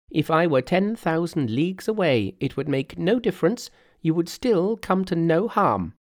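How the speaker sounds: high-pitched Monkey voice; reassuring Monkey. Acted. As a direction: promise protection in a high character voice.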